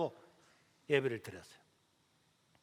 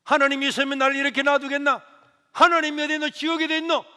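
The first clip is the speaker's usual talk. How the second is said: high, loud scornful shouting; mother angrily denying God to her son; mother's angry retort; 8 semitones higher, 8 dB louder than usual. Retold or imitated. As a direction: hurl the questions back in defiance. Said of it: "막 하는데".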